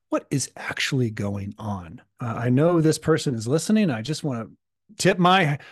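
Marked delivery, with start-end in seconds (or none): none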